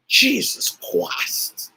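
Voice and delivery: rough, drawn-out mock preacher cry; slow, forced preacher impression; favorite prophet. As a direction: imitate a prophet calling out, words stretched. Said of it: "One of my favorite prophets".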